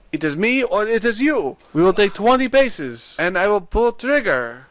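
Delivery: high mock European-accent duelist; Spiro. Spiro challenging rival to a duel, high European accent. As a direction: build to a loud, high cry in a European accent. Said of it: "pull out, like, a pistol and say".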